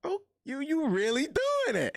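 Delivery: mom's voice jumping way up in amazement; amazed mom. Retold or imitated. Mom seeing her son succeed, squealing in amazement. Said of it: "She like".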